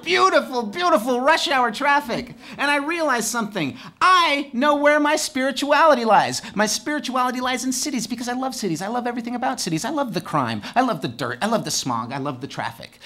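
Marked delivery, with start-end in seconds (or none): 0.0-2.3 mock-rapturous, much higher, slow; sarcastic praise. Ironic praise of traffic. 4.0-8.5 grand revelation. Acted. Mock revelation.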